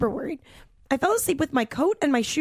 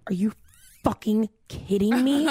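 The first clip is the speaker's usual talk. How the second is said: retold mad disbelief; 3 semitones lower than usual. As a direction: break it up with pauses, incredulous. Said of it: "I was so mad".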